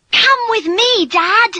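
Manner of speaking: high, loud excited call; bubbling, high-pitched girl's invitation; eager urging. Acted. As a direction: urge your father along excitedly. Daughter eagerly fetches her father.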